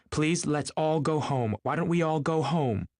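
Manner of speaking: high, drawn-out plea; helpless pleading. A narrator's reading. Daisy begging everyone to go home, helpless pleading. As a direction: beg them helplessly, pitched well up. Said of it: "she interrupted helplessly".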